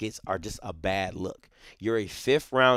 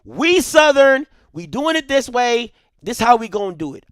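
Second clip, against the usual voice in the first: proud coach declaring; 12 semitones higher, 8 dB louder than usual. Pretend. Imagined coach standing on business for Southern, defiant declaration. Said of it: "stand on business and say".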